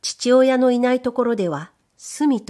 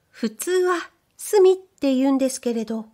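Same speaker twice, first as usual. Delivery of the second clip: higher, bashful maid's voice; shy young maid; 6 semitones higher than usual. Acted. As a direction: play the timid young servant girl.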